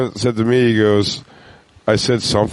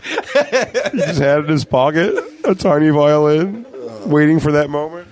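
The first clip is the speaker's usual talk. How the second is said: laughing through it; 6 semitones higher than usual. Real, not acted. Laughing while retelling the gag.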